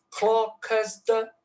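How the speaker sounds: high, slow "clock as the"; dactyl stress. Shown as a demonstration. Lecturer scanning another dactyl, high slow "clock as the". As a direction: intone "clock as the", first beat strong. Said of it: "é outro dátilo".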